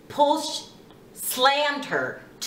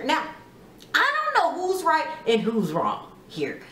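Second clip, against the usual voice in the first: ordinary delivery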